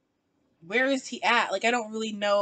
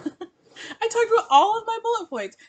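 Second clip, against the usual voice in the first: higher, laughing through the words; 11 semitones higher, 4 dB louder than usual. Real, not acted. Host proudly wrapping up her video, laughing. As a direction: giggle out the pride.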